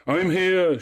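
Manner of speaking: high, loud echoing call; crying out. A narrator's reading. Echo catches at Narcissus's words, crying out high. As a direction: call it back, sustained and falling. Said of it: "she cried".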